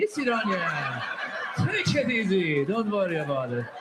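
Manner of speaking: sleepy, very slow Iranian accent. Shown as a demonstration. Comedian mocks Iranians talking in sleepy Iranian accent. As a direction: drag it out like you're falling asleep, Iranian accent. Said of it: "they talk a lot slower".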